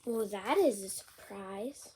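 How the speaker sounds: lively picture-book read-aloud; bouncy storybook reading; reading to children. Acted. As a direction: read it out with big character voices for a kid.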